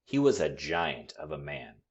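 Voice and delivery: ordinary delivery, nothing marked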